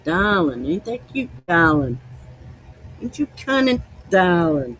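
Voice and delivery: hammed-up Maine accent; Maine lady impression. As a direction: coo like a Maine grandma. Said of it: "everybody from Maine knows".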